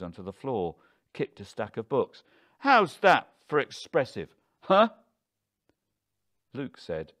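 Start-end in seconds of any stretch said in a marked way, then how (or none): 2.6-4.9 loud, high taunt with a goading end; furious, defiant challenge; frenzied anger. A narrator's reading. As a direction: taunt them both at full voice. Husband in frenzy kicking books.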